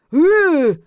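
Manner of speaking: high, loud wordless protest; long outraged undead groan; rising indignant zombie wail; indignant zombie moan. A narrator's reading. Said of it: "возмутился зомби".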